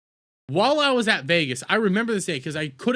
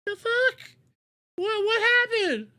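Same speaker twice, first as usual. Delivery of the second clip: shocked; slower than usual. Acted. Shocked return from vacation.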